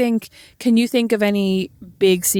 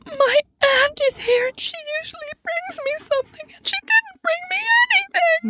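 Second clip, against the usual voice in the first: much higher, slow, wailing fake cry; mock crying child. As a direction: wail like a sulking kid. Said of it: "And he goes".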